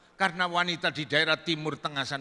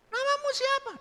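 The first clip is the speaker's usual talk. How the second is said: squeaky-high falsetto questioning; woman's voice. Acted. Woman asking the visitor's name, high falsetto.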